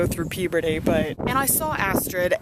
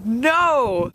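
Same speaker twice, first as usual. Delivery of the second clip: dismayed cry; 5 semitones higher, 4 dB louder than usual. Retold or imitated. Fearing friendship lost.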